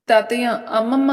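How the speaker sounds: shouted summons, narrator acting it out; loud calling. A narrator's reading. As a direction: call out loudly through the house. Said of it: "గట్టిగా అరుస్తుంది".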